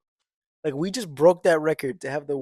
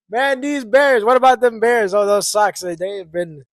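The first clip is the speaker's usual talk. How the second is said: higher, louder hammed-up fan; Chicago sports fan voice; 4 semitones higher, 6 dB louder than usual. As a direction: ham up a Bears superfan.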